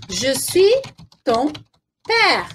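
spaced out word by word, pausing between them. Shown as a demonstration. Teacher showing the class the father's line, word by word. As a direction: break it up slowly for learners. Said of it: "Olha só que legal".

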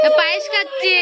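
very high sing-song cooing. Real, not acted. Mother coos to her baby eating payesh, high and sing-song.

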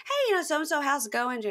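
bright, high singsong phone greeting; chatty mom. Retold or imitated. Son mimics mom's cheery phone chat, high and sunny.

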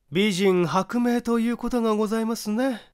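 high, prim housewife voice put on serious; mock-solemn gossip. Neighbour wife solemnly quotes a proverb, high prim voice. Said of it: "真面目腐って".

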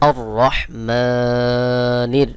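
আর রহমানির with মা held long; slow recital, rolled র and lengthened মা; one-alif stretch. Shown as a demonstration. Teacher joins syllables for learners, আর রহমানির with stretched মা. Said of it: "খাড়া জবর এক আলিফ টান".

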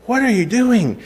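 mock wife asking what's going on; wife's puzzled question. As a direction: ask it as the baffled wife would. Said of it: "And Susan's like".

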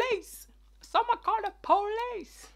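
high, loud call for the police; mock cry for help. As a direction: shout for help in playful panic.

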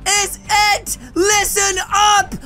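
slow, high-pitched telling-off; fed-up, slowly stretched command; exasperated scolding. Pretend. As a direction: lay down the law, drawn out. Scolding the girls after being hit.

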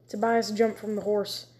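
ordinary delivery, nothing marked

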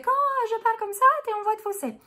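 very high falsetto speaking voice. Shown as a demonstration. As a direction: jump right up into head voice and speak there. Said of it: "on utilise beaucoup la voix de fausset". (voix de fausset)

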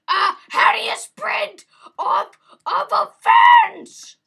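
very high, slow, loud shouting; shrieked, drawn-out exasperation; emotional screaming. Real, not acted. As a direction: scream each phrase in frustration. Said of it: "I get emotional".

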